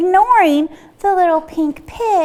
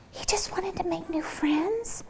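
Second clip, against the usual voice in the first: unvoiced, softer wondering whisper; 3 semitones higher, 14 dB quieter than usual. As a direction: whisper the realization. Animals realizing, whispered.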